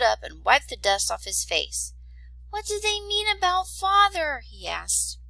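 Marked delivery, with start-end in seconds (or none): 2.5-4.4 put-on much higher worried child; small boy voice. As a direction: ask like a frightened little boy. Little brother asks worried.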